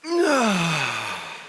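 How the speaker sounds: long, falling sigh of relief; deep exhaling sigh. Shown as a demonstration. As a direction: breathe out slowly as if exhaling cigarette smoke. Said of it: "and you go like this".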